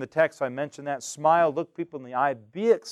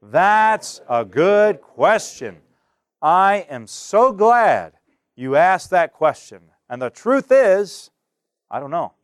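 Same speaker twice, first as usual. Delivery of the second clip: slow, loud, steady; slow group recitation of the model reply; slow model answer; 3 semitones higher, 7 dB louder, slower than usual. Shown as a demonstration. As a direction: say it slowly for the class to repeat. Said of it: "Let's all say that together".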